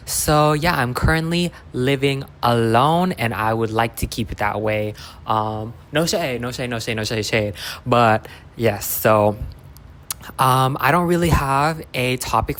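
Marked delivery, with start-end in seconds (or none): none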